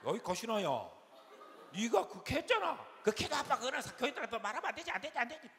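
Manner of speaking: high, loud, rapid retort; quarrelsome shouting. Retold or imitated. Family arguing over what dad told the congregation, shouting. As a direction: imitate a heated family row at full voice. Acted quarrel of father and daughter.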